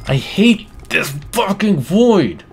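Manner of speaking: high, exasperated cry; frustrated outburst. Real, not acted. Player cursing the void in game, exasperated. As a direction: let the frustration burst out high and punchy.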